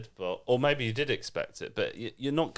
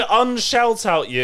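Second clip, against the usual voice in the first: mock call-out; 10 semitones higher, 9 dB louder than usual. Jokey call-out of Tom.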